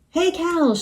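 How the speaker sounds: high-pitched, mild shout; shouting rooster. A narrator's reading. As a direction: call out as an indignant rooster, lightly. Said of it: "shouted the rooster".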